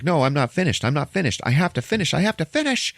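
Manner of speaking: high protest climbing to a shriek; hammed-up frantic insistence; freaking out. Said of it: "He's freaking out".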